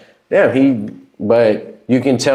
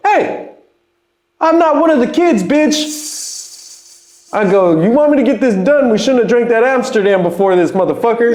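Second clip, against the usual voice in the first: high, heated mock comeback; mock talking back; 14 semitones higher than usual. Rapper snapping back at the engineer, high mock anger. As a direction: snap back, hammed-up and high.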